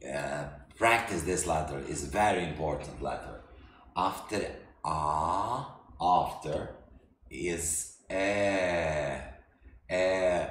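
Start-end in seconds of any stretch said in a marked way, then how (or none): none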